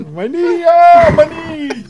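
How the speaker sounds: high, slow hammed-up cry over a knee; mock wail of pain. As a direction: whine like you just shot yourself. Acting out a man shot in the knee.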